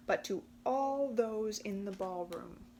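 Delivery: ordinary delivery, nothing marked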